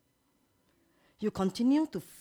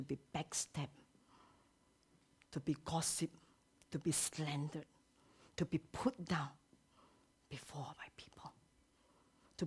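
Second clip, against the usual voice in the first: hushed; 4 semitones lower, 11 dB quieter than usual. Real, not acted. Preacher drops to a hush.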